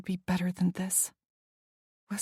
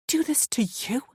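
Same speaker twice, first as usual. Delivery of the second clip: hissed; 6 semitones higher than usual. A narrator's reading. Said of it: "I hissed".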